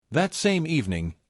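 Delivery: usual speaking voice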